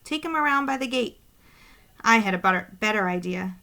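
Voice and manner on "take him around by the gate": called out. A narrator's reading. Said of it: "She called".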